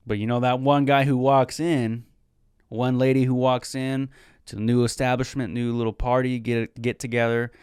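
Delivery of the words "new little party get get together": normal talking voice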